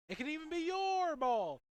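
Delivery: high, loud, slow teasing proclamation; mock-grand tease. Commentator teasing listeners at home, high, loud and slow. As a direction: announce it grandly to the audience.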